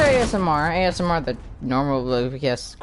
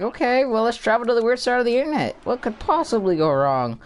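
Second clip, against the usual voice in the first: high-pitched, rushed, breezy retelling of a thought; lightly acted carefree confidence; mock naive optimism; 9 semitones higher than usual. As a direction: play your own blithe past self, pitched up. Quotes own naive earlier thought.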